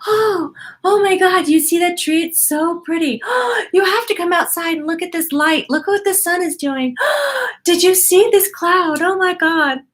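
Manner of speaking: high, gasping, hammed-up amazement; gushing wonder. Mom as mimicked by her son, marvelling at nature, gushing wonder. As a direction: gush in mock awe between big gasps. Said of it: "You walk around like".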